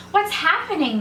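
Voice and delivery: high-pitched call. A narrator's reading. Third rabbit to the passing rabbits, calling out. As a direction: call out the question in alarm. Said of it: "the third rabbit called out".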